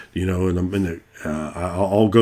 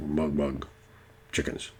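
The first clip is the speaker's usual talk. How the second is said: soft, odd little creature voice; a tiny creature murmuring its own name; homunculus voice; 6 semitones lower, 14 dB quieter, slower than usual. Acted. DM voicing the homunculus to players, soft creature murmur. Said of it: "And so it would talk".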